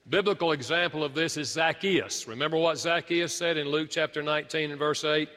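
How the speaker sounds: ordinary delivery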